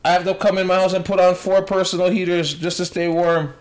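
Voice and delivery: high-voiced mock news interviewee; lady on the news. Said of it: "I seen the one lady on the news".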